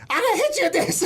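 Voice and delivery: cheeky challenger. Acted. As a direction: taunt the giant like a small kid. David warning Goliath.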